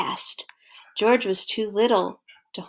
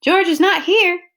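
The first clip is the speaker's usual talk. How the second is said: higher, louder alarm; the man worried George is missing; 8 semitones higher, 6 dB louder than usual. Acted. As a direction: sound the alarm.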